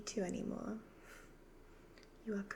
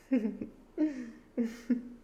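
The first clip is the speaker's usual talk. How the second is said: long, louder wicked cackle; loud evil cackle; 2 semitones higher, 7 dB louder than usual. Acted. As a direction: laugh in triumph at your doomed victim. Devil mocks victim's remaining time.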